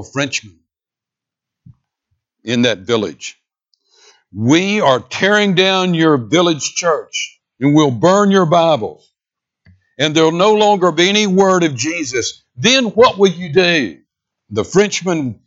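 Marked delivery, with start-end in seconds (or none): 4.3-14.0 diabolical leader. Acted. Revolutionary leader threatening an evangelical villager, menacing proclamation. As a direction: declare the threat like a village-burning tyrant. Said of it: "one of the diabolical leaders".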